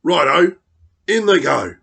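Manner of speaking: gleeful dingo. Acted. Dingo eagerly agrees to Lizard, loud gleeful cry. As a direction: rub your paws and cheer it. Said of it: "rubbing his paws together".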